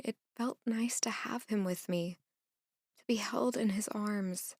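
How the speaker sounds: soft breathy whisper, barely voiced; hushed tender confession. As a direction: breathe it like a secret comfort. Tender private memory of being held.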